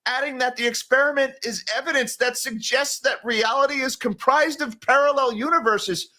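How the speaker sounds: high-pitched, disbelieving read-out; incredulous reading. Real, not acted. Host reading an absurd multiverse claim, incredulous and high. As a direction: read it out as if you can't believe it.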